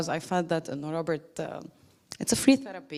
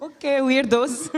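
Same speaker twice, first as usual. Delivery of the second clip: higher, louder, slow, laughing; laughing tease; 7 semitones higher, 6 dB louder, slower than usual. Retold or imitated. To the workshop group, slow laughing tease. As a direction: drag it out through laughter, teasing. Her reaction to crying group.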